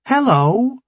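high, bright hello; chirpy young woman. Acted. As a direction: greet them bright and eager. Chirpy scientist greets them.

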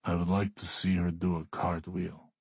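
mildly bored wish; uninterested. A narrator's reading. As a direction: ask without any enthusiasm. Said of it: "Alexei sounds uninterested".

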